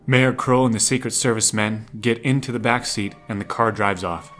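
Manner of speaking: usual speaking voice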